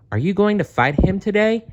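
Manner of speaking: high, quick worried question; a nervous friend blurting it out fast; fretful boy, high and rushed; anxious. Acted. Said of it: "Fred asked anxiously".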